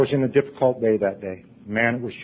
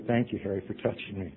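quiet thanks; 3 semitones lower, 9 dB quieter than usual. Retold or imitated. Quoting unspoken thanks.

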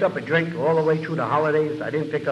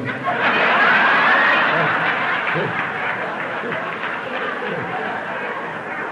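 laughing. Real, not acted. Audience-style laugh after punchline.

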